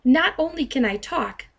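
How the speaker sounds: ordinary delivery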